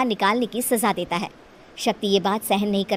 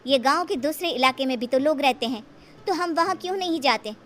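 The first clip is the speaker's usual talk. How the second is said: put-on higher, curious young girl voice; young Shakti asking; 5 semitones higher than usual. Young Shakti asks the elder eunuch, higher and curious. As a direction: ask innocently like a young girl.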